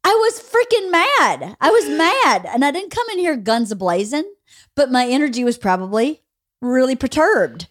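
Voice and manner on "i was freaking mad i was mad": high-pitched, emphatic mad-mom retort; acted frustrated admission, pitched up; retold exasperation. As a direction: punch it out high, exasperated with the kid. Retells own exasperated admission to daughter.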